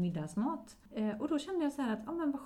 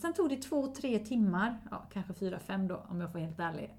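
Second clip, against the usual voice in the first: usual speaking voice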